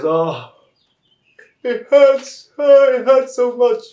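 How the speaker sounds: very high, loud mock wail; hammed-up tearful elephant in pain; acted crying. Elephant answering Mister Mouse, hammed-up crying. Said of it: "he's crying".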